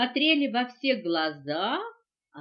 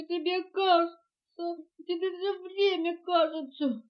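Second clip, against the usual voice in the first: sobbing; 7 semitones higher, 4 dB louder than usual. A narrator's reading. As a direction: whine it through tears. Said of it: "всхлипнула мартышка". (плаксиво, всхлипывая)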